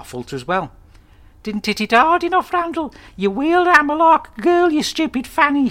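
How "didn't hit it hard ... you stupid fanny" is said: put-on much higher, louder shrieking old woman; nan impression. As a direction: screech it as the scolding nan. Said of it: "she said".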